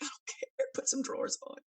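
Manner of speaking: exasperated. Retold or imitated. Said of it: "I'm like".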